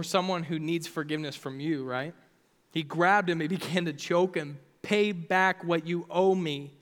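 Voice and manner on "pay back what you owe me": slow, emphatic demand. Acted. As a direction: demand repayment, each word drawn out. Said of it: "he demanded".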